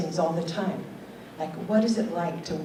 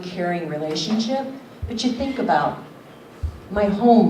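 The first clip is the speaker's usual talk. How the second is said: usual speaking voice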